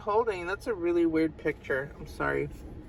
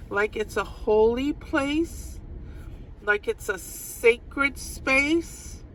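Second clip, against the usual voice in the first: slow, solemn emphasis; 3 semitones higher, slower than usual. Real, not acted. Slowing down on sacred words.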